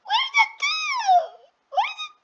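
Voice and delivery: squeaky panicked where; high squeal. Real, not acted. Gamer squealing at a hidden enemy, high and panicked. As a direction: shriek the question up high in alarm.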